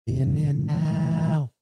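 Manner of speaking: slow sing-song then and now; drawn out musing. Real, not acted. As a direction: stretch the words out slowly. Musing while searching.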